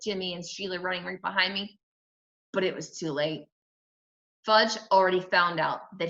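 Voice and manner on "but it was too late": ordinary delivery, nothing marked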